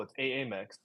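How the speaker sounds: "A A Mex" as ay-AY-mex, tossed off quietly; Key and Peele style. Retold or imitated. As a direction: double the first A in "A A Mex". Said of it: "If you wanna be Key and Peele".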